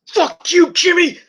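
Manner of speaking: high, loud acted outburst; mock psychotic rage. Retelling a wrestler turning on his brother, psychotic yell. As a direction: imitate a wrestler snapping, voice shooting way up. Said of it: "he just goes psychotic".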